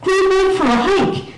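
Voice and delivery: high, drawn-out mock cheer; sarcastic. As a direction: call it out with heavy sarcasm. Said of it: "I'm being sarcastic".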